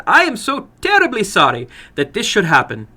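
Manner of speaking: ashamed, pleading apology; apologetic older woman. Acted. Woman voiced in story.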